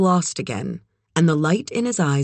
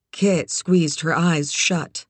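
usual speaking voice

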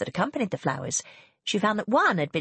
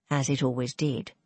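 ordinary delivery

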